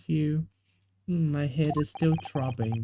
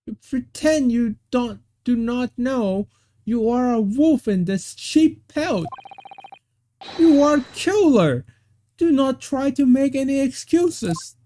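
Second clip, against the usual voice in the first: higher, louder accusation building to "killer"; accusing; 7 semitones higher, 4 dB louder than usual. Acted. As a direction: point the finger and denounce. Accusing the defendant of murder.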